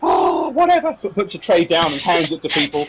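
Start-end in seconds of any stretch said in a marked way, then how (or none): 0.0-0.9 high-pitched, exasperated dismissal; put-upon serving girl; fed-up barmaid. Acted. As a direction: brush the boss off as a harried barmaid. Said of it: "Right, she kind of".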